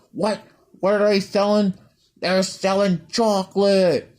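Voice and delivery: high-pitched cartoon fish shouting; drawn-out, high hammed-up character voice; bad cartoon impression. Claire mimicking a fish from a cartoon, bad impression. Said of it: "I'm terrible at impressions".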